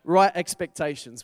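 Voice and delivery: emphatic loud heading. Shown as a demonstration. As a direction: punch "Right expectations" out loud and firm. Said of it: "you ready for number two?".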